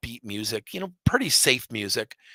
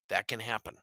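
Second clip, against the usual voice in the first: ordinary talk, nothing marked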